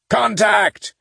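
high shouted alert. A narrator's reading. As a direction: yell it out to the squad. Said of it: "They responded in shouts of".

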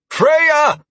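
shouted name, high and loud; calling out. A narrator's reading. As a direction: call across the battlefield. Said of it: "I called out".

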